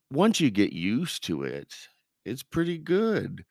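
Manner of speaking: mock grudging compliment, pitch lifting high; backhanded faint praise. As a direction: imitate a critic damning with faint praise. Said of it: "it was in a backhanded way".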